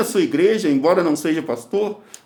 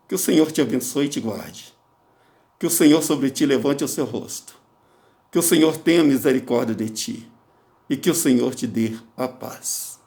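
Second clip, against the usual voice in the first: blessing; 5 semitones lower than usual. Acted. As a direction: bless them gently and solemnly. Pronounces blessing.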